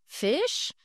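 a puzzled, rising "Fish?"; surprised. Acted. Mother surprised at her son's purchase, puzzled. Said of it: "Missus Huggins sounded surprised".

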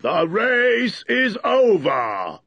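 slow, high, loud announcement; booming drawn-out dodo proclamation; calling out. A narrator's reading. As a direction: call it out long and drawn to everyone. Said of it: "the dodo suddenly called out".

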